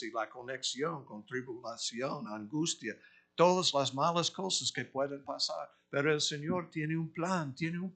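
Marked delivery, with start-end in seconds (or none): none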